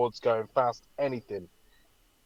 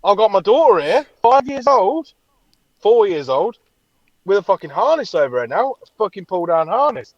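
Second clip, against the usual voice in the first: higher, louder, panicked rising rant; 8 semitones higher, 9 dB louder than usual. Retold or imitated. Panicked retelling of ride.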